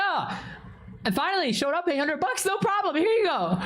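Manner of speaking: high, airy put-on voice; carefree rich buyer. Streamer imagines having money to spare, mock carefree. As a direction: breeze through it in a high mock-casual voice.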